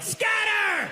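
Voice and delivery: very high, stretched-out scream; yelling. Retold or imitated. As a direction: shriek the warning to the whole room. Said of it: "and yelled".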